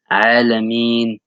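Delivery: "Alameen" slowly, ending like English "mean". Shown as a demonstration. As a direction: stretch "Alameen" so it ends in "mean". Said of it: "as if you were saying the word mean".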